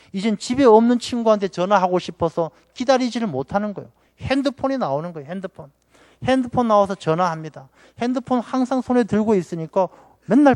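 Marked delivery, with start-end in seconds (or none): 5.3-5.7 핸드폰 repeated much softer, almost under the breath; soft echo. Shown as a demonstration. Preacher echoes the word to the church, softly. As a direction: murmur 핸드폰 as a quiet aside. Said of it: "repeats the word quietly".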